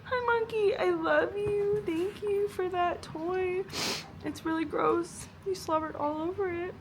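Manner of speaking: real tearful tenderness. Real, not acted. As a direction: coo to the dog through tears. Crying, talking to dog.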